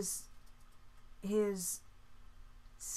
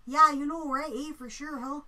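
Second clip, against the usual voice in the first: put-on higher-pitched Canadian accent parody; mock Canadian accent; 4 semitones higher, 3 dB louder than usual. Streamer mocking Canadian vowels and "eh", exaggerated Canadian accent. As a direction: imitate Canadian "eh" and long O's. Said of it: "the A's and the O's and the".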